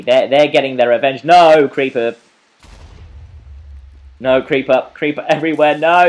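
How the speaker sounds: loud, urgent warning cry; alarmed gamer shouting; panicked alarm. Real, not acted. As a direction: call out in panic as enemies close in. Alarm at creepers closing in.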